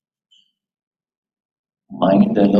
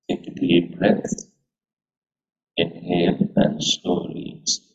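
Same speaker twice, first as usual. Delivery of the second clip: guided meditation. Acted. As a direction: guide them gently into stillness. Leads a meditation.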